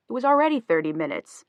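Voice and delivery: playful whine of a haggler; whining. A narrator's reading. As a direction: complain with a bit of a whine. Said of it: "Julian whined".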